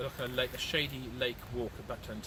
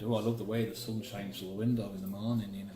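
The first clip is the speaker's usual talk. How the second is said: light Scottish accent impression; a lightly done Scottish lilt, imitating George; mild Scottish accent quoting an old remark; 3 semitones lower than usual. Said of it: "That's my Scottish accent for you".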